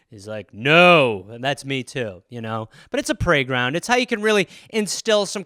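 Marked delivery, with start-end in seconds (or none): 0.5-1.2 mock god voice. God answering the kids.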